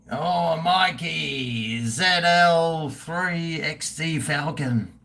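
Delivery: very slow, dreamy drawl over the name; mock-wistful slow croon; drawn-out savouring. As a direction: linger lovingly on each word. Savouring a viewer's car name.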